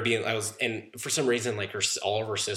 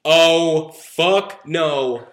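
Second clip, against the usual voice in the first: loud, higher indignant refusal; retold angry outburst; 5 semitones higher, 7 dB louder, much slower than usual. Telling friends how they stormed out, angry outburst. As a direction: blurt it out, a mock defiant yell.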